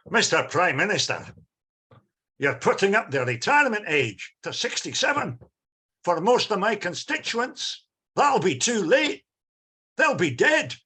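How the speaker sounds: lightly put-on Scottish accent for a speech in the Commons. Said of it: "this is a Scottish accent".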